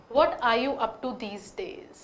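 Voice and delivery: very slow "What are you up to these days?"; slow read. Shown as a demonstration. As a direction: read it slowly word by word. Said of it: "एक बार पढ़ती हूँ". (धीरे-धीरे पढ़ें)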